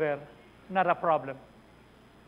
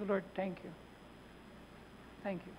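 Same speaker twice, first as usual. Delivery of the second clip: whispered-soft prayer; 15 dB quieter than usual. Retold or imitated. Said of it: "Sabi ko".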